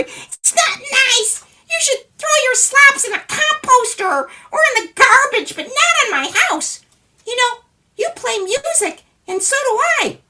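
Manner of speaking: tiny man lecturing; scolding little man. Acted. Little man telling neighbor where slops belong, high tiny voice.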